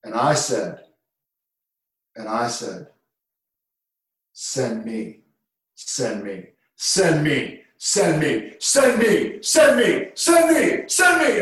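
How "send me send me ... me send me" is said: building to a shout. Retold or imitated. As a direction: start hushed, then crank it up to a roar. Pre-game prayer builds to a roar.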